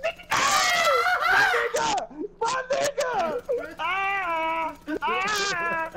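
excited yell. Real, not acted. Friend shot him, yelling.

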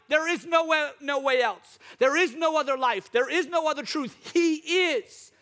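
loud, high preaching climax; full-force preaching. Real, not acted. Pastor proclaiming Jesus to the church, loud and high. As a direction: drive it home to the church.

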